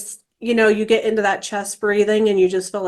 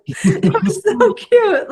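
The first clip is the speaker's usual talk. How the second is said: laughing; 11 semitones higher, 3 dB louder, slower than usual. Real, not acted. Mother laughing about daughter.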